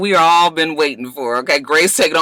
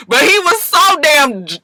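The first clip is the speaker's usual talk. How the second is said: ordinary talk, nothing marked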